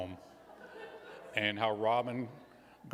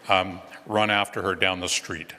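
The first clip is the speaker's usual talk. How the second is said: usual speaking voice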